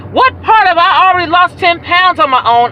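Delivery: high, exasperated comeback; indignant retort. Retold or imitated. Argues back at the saleswoman.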